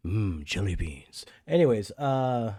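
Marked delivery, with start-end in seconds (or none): 0.1-1.3 put-on low, slow old president voice; Ronald Reagan. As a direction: savour it slowly like an old president. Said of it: "I could do Ronald Reagan".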